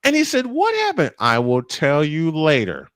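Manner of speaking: usual speaking voice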